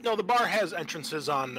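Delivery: ordinary delivery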